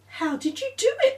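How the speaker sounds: very high and fast; quick squeaky question; Nick's voice. Acted. Nick asking Claire, high and fast. Nick asks about knee.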